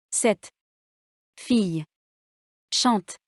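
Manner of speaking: Cette, fille, chante said as separate words, each stressed, long pauses between. Shown as a demonstration. Teacher showing written words one at a time, word by word. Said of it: "Faites attention à l'accent tonique".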